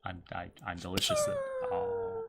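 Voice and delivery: slow eerie whisper; creepy whisper. Acted. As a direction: whisper it like a sinister treat. Ice cream voiced as character.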